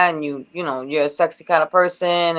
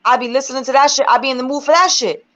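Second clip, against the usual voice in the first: pitched way up, fired-up venting; heated rant; 7 semitones higher than usual. Real, not acted. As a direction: get worked up and let it fly high. Heated rant about judging women.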